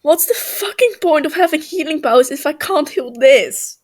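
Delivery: loud, high, hammed-up complaint; overdramatic whine.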